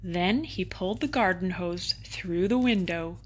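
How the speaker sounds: ordinary delivery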